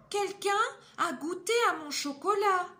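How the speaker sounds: voix de maman ours plus haute et plus forte; la maman ours s'étonne, voix haute; maman ours aiguë. Acted. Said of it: "Et Maman Ours dit". (Maman Ours découvre le chocolat goûté, voix aiguë)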